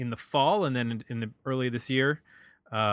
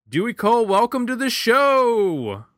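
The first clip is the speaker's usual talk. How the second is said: excited announcer voice; 9 semitones higher, 6 dB louder than usual. Shown as a demonstration. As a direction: announce the guest like he's coming down the aisle on The Price is Right. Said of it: "That was me doing my excited announcer voice".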